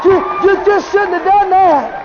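high, loud, drawn-out taunt to the devil; defiant taunt. Acted. Preacher taunts the devil while laughing in his face, defiant. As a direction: tell him off, pitched high and relishing it. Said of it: "and you say".

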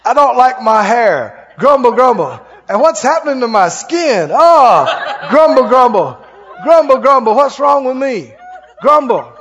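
loud, hammed-up complaining; mock grumbling. Complaining at the mirror about hair and skin, mock grumbling. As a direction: moan and grumble loudly for comic effect. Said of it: "Stumble and grumble".

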